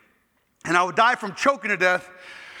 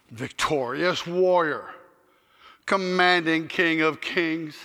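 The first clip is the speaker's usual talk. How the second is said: slow, lower reverent prayer voice; 5 semitones lower, slower than usual. Acted. Preacher closing in prayer to God, reverent and slow. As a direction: praise God slowly and solemnly.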